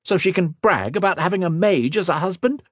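high-pitched, mounting outrage; angry snap. A narrator's reading. Woman refuses to let a rival ruin her life, snapping. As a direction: rant with indignant fury. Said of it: "she snapped".

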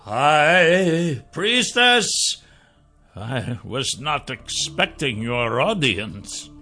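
slow, drawn-out, slightly shaken sorcerer; strong man faintly trembling; strong voice trembling a little. A narrator's reading. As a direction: address a superior slowly, a touch unnerved. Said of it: "His strong voice trembled a little".

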